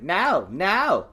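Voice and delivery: high, loud retold protest; mock protest. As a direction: act out fending someone off. Acting the tussle over the object.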